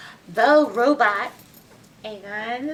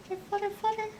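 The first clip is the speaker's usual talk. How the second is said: light fluttering; 10 semitones higher, 9 dB quieter, much faster than usual. Acted. Reader mimics fluttering heart.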